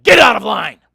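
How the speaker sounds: full-force furious shout, high and loud; scream. Retold or imitated. Irate man screaming at a woman who cut in line, furious scream. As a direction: explode at someone ahead of you. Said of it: "He screams at the back of her head".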